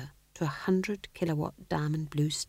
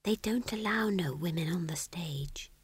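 meek near-whisper; small voice; 2 semitones higher, 7 dB quieter than usual. A narrator's reading. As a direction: shrink into a tiny, soft voice. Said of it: "said Magrat in a small voice".

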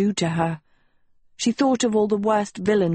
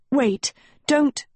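frightened plea; 6 semitones higher than usual. A narrator's reading. Agatha pleading in fear.

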